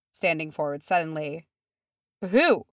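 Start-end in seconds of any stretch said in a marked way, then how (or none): 2.2-2.7 high, loud demand; sharp, raised, dreadful question. A narrator's reading. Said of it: "in a dreadful voice".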